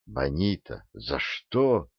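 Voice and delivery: very soft, faint dying words; dying whisper. A narrator's reading. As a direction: breathe it out as life fades. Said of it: "прошептал обескровленными губами". (шёпот умирающего)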